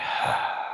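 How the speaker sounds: breathy sigh with no voice; unvoiced sigh out. Shown as a demonstration. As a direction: let the air out in a long sigh. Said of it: "we do it with a sigh".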